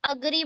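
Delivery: "अग्री" begins with a short अ, not ए; short a sound. Shown as a demonstration. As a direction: say "अग्री" opening on a clipped अ. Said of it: "एग नहीं, यहाँ पर". ("अग्री" को छोटे अ से बोलना)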